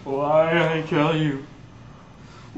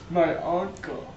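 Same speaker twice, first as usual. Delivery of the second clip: ordinary talk, nothing marked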